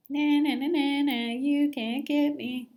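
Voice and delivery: high, loud singsong taunt; taunting fish. A narrator's reading. Cheeky fish teasing the fishermen, high and singsong. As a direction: sing it out to tease.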